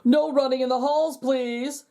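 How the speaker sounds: frog principal calling after students. A narrator's reading. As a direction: raise the voice to stop them. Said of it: "called Mister Bundy".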